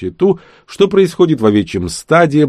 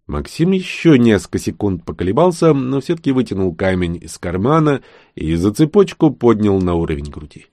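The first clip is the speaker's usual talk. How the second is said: ordinary delivery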